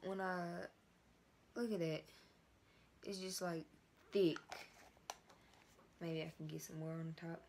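stiff mask around mouth; wearing a face mask. Real, not acted. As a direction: lips framed by mask.

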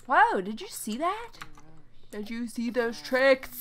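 loud, high wide-eyed whoa; a loud, stunned wow at a dancer; amazed. Acted. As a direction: gasp at the tricks in awe.

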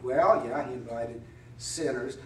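ordinary delivery

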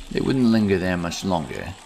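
ordinary delivery

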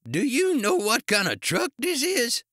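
put-on high, loud, indignant old-man character voice; angry challenge. A narrator's reading. Ice cream man confronting the officer about his truck, outraged. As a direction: demand it at a high pitch.

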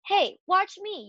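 high-pitched show-off call; excited storybook yell at moderate volume; Avery's yell. A narrator's reading. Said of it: "yelled Avery".

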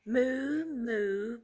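loud, drawn-out moos; cow mooing. A narrator's reading. Reader reviews Mister Brown's sounds for children, mooing. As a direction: moo like a cow. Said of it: "let's review".

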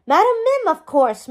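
high, loud sudden blurt. A narrator's reading. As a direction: blurt the name out impatiently. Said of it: "Merlin blurted".

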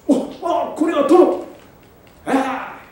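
high stammering gasp; shocked. Acted. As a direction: realize in horror who he is. Officers realize it is the lord.

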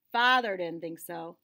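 ordinary delivery

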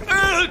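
loud exasperated ugh; frustrated groan. Real, not acted. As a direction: groan it out in disgust. Frustrated after dying.

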